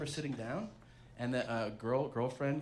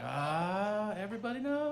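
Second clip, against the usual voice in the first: much higher, laughing groan; mock dismay; 8 semitones higher than usual. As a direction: sigh it out in pretend despair. Parents walk into room.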